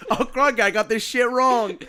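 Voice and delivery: a hammed-up Australian slip; mock Australian accent. Host laughing through a bad Australian accent. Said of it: "my Australian Ireland wannabe".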